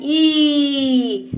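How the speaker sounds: ई sustained on a steady note; held long. Shown as a demonstration. Teacher shows pupils the long vowel, sustained. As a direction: hold ई out long before letting it fall. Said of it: "हम देर तक करते हैं".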